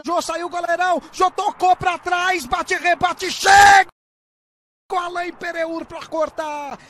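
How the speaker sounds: loud rising scramble call, shouted finish; shouting play-by-play. Real, not acted. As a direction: yell the scramble, then explode. Goalmouth scramble.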